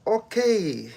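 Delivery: a high, loud delighted okay; light up at the offer; sudden thrilled okay; excited okay. Real, not acted. Reacts to a viewer's offer of help.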